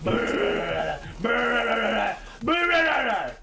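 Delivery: rolled brrr of a minigun; imitated gunfire. Player mimicking the new minigun, rolled gunfire noise. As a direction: trill it like rapid fire, climbing.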